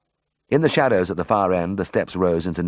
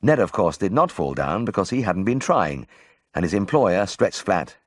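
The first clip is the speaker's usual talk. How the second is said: ordinary delivery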